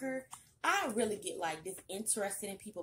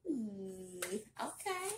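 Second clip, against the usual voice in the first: usual speaking voice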